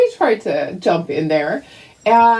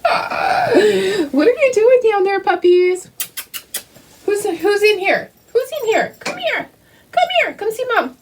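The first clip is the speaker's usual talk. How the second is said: high sing-song voice calling the dogs; pet talk; 10 semitones higher than usual. Real, not acted. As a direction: coo at the puppies like babies. Calling the puppies over.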